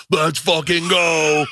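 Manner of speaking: slow, drawn-out shout of the catchphrase. Retold or imitated. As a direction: bellow it like a bro about to chug a beer. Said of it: "you are indeed the fat going".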